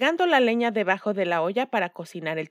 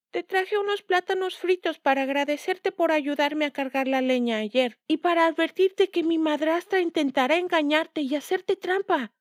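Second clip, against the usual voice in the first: put-on little girl voice; 8 semitones higher than usual. A narrator's reading. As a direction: speak as an earnest little girl. Waslin habla con la señora. (voz aguda de niña)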